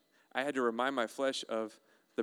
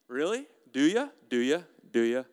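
punchy repeated challenge, stepping down in pitch; sceptical needling; 6 dB louder than usual. Acted. Preacher challenging someone who has to vent, sceptical needling. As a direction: needle them with short, doubting questions.

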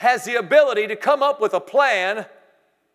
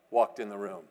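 far lower and softer, hushed; hushed awe; 11 semitones lower, 8 dB quieter than usual. Acted. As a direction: drop to an awed near-whisper. Preacher drops voice for effect.

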